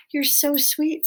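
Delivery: high-voiced princess cooing; sweet princess. A narrator's reading. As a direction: coo it high to a tiny mouse. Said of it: "said the princess to Despereaux".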